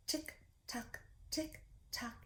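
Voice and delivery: soft unvoiced ticking, clock-like; whispered tick tock. Counting clock ticks with children.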